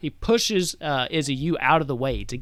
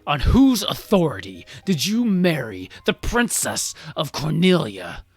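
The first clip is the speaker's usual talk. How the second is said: indignant demand; 2 semitones higher, 6 dB louder than usual. Acted. King demands who allowed the wedding, indignant. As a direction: hammer the key words in outrage. King confronts daughter's husband.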